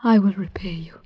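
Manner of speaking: soft hushed promise; whispered. Acted. To her lover, whispered vow. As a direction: promise it in a breath.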